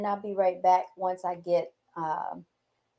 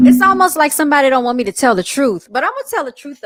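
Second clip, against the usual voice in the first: fired up; 6 semitones higher, 12 dB louder, faster than usual. Real, not acted. Fired-up rant about sabotage.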